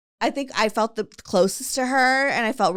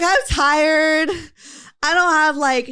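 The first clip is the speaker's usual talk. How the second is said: mocking friend; 8 semitones higher, 7 dB louder, slower than usual. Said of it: "she's like".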